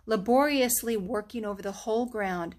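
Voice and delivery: ordinary delivery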